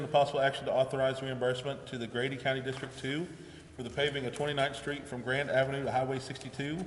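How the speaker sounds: ordinary talk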